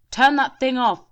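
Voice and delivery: high, loud shout. A narrator's reading. Officer shouting at Shelley to stop the machine. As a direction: shout an order. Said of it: "shouted Denny".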